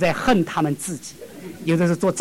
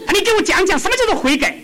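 high, loud, fast challenge; officer demanding; 13 semitones higher, 5 dB louder, faster than usual. Retold or imitated. Officer challenging the singing soldier, high loud demand.

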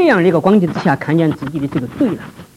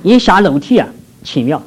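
usual speaking voice

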